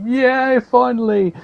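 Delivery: high-pitched mock cheer; high celebratory squeal, retold; relieved cheer. Retelling relief at last find.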